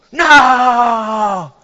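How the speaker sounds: put-on a bratty kid's loud NO; mock teen outburst. Teen refuses chores.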